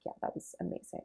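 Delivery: near-silent whisper to herself; whispered self-praise; whispered inner thought. Retold or imitated. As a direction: breathe it out like a private thought. Quoting own inner satisfied thought.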